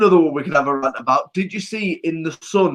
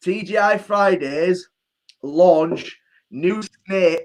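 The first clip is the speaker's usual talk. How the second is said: usual speaking voice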